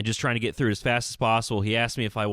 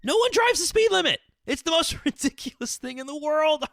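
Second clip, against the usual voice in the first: exasperated disbelief; 10 semitones higher than usual. Retold or imitated. Telling the judge what he wanted to say, exasperated and climbing. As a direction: let the outrage climb until you laugh at how absurd it is. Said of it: "not to roll my eyes and go".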